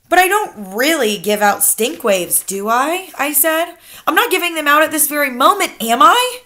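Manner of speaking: put-on quick, high worried boy; anxious child. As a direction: rush the worried questions. Worried boy questions grandmother.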